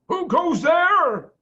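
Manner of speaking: a slow, challenging call from overhead; voice from above. A narrator's reading. Unseen badger above the tunnel, challenging call. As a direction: stretch out a guard's challenge. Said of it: "a deep voice above their heads said".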